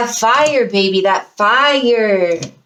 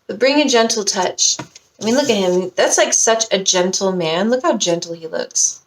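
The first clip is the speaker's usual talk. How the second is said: ordinary talk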